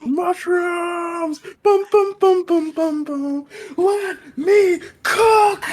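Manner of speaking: very high, loud chanting shriek; rage-chant like a mad cook; berserk rage. Acted. Character raging about mushrooms.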